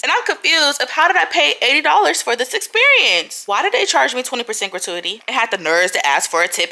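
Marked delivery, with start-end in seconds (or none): 0.0-5.2 indignant disbelief. Real, not acted. Ranting about the eighty dollar bill.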